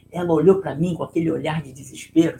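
ordinary talk

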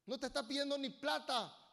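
ordinary talk

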